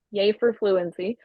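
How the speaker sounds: ordinary talk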